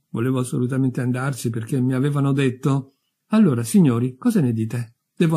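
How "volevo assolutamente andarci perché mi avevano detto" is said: ordinary delivery, nothing marked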